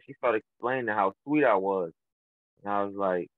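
ordinary talk

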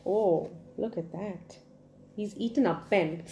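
ordinary delivery

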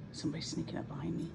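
hushed quick whisper. Real, not acted. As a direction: whisper it so the cat won't hear. Cat sneaking up behind her.

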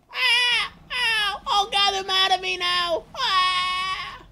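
high, loud cries; panicked wailing. Real, not acted. Player under attack in game, panicked wail. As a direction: wail as the hits land.

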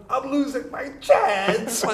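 high, drawn-out retold wail; hammed-up anguish at missing out; mock desperation. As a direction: stretch it out high, playing up the panic. Acting out his panic at missing [name].

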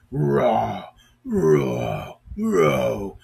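deep, drawn-out roar from a trapped lion. Acted. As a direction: let out a long roar. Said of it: "heard a terrible sound".

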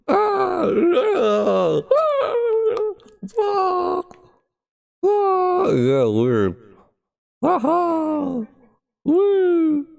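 very high falsetto whoops; playful high-pitched test calls into the mic. Pretend. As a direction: squeal up high to test the echo. Testing echo with high calls.

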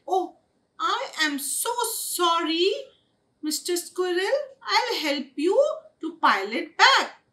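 high-pitched, dismayed kitten apology; earnest apology. A narrator's reading. Goldie says sorry to Mister Squirrel, earnest apology.

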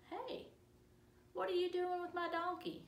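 high, loud challenge; indignant donkey owner. Acted. Donkey owner to disciples taking his donkey, indignant. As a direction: call out sharply at someone taking your donkey.

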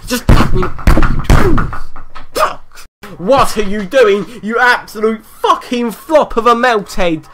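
furious yelling at the screen; real anger. Real, not acted. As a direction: curse the useless player. Raging at his player.

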